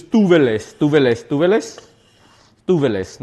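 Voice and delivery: "tuveles" with a v sound; corrected pronunciation. Shown as a demonstration. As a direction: say "tuveles" as TOO-vuh-lis. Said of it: "I looked up how to say it".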